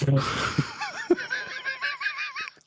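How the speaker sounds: high burst of real laughter; a sudden high laugh. Real, not acted. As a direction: break up laughing at the joke. Laughs at partner's joke.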